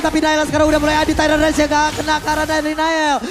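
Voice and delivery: ordinary delivery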